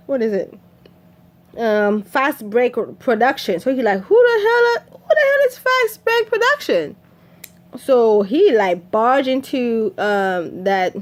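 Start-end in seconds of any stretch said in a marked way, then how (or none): 4.0-7.0 high, loud, incredulous demand; retold shrill-pitched yell of disbelief; indignant outburst. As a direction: voice shoots up in outraged disbelief. Acted outburst on reading the album.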